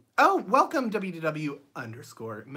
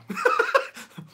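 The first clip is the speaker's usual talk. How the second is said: burst of laughter; 8 dB louder than usual. Real, not acted. Gets the song joke.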